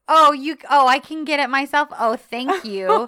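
high, loud, slowed mock-innocent voice; mock coy heroine. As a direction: play the flustered heroine, hammed-up. Voicing Sanem teasingly.

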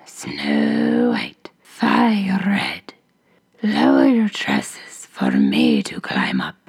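hoarse whispering ogress call. A narrator's reading. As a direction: rasp it out like a menacing ogress. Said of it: "who cried".

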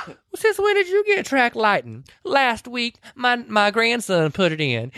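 a playful needling of a friend; teasing lady voice. Retold or imitated. Friend teases Weezer about her new track lighting, high voice.

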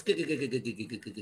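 lightly imitated Popeye laugh on a repeated syllable; mock sailor-cartoon chortle; Popeye chuckle. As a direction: rattle off a low, quiet cartoon chuckle. Popeye's chuckle mimicked after he's mentioned.

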